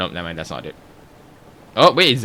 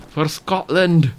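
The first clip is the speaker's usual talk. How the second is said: Scottish battle cry; 6 semitones higher, 3 dB louder, slower than usual. Acted. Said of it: "butchering every single accent".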